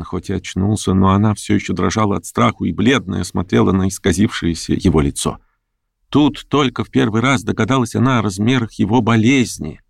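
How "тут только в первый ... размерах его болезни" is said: ordinary delivery, nothing marked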